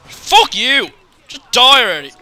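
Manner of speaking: loud, frustrated yell; angry shout. Real, not acted. Gamer yelling loudly at an enemy who won't die.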